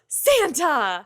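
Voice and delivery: startled, caught off guard; gasping surprise. Acted. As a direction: gasp and blurt out his name. Surprised girl sees Santa appear.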